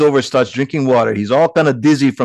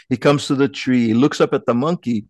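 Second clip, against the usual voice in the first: ordinary delivery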